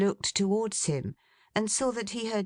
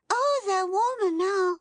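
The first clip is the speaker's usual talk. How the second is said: put-on very high, louder little child's voice; child exclaiming; 4 dB louder than usual. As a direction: pipe it up like an eager little girl. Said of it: "exclaimed the child".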